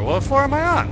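sudden high alarmed question; startled. Real, not acted. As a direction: jolt up in surprise. Startled horror game moment.

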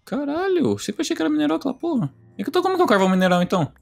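high-pitched amazed outburst, rushing at the end; shocked disbelief. Real, not acted. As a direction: squeal in real surprise. Shocked at vegetable coal fact. (surpresa chocada, aguda)

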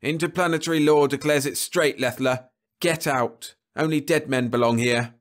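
loud, high, deliberate command; slow defiant order. Acted. Rice ordering Leffler off the ship, loud and slow. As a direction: lay down the law slowly. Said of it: "Rice said it slow".

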